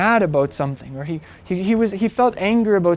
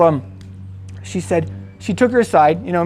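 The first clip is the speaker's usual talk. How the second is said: ordinary talk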